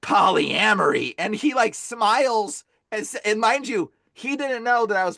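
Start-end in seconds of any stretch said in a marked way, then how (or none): 0.0-1.2 stuttered pops into a slow, drawn-out word; eerie sputter, then a slow reveal; weird demon taunt. Retold or imitated. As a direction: imitate a demon toying with its victim. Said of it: "he does this crazy weird thing".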